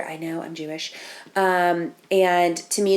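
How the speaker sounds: ordinary delivery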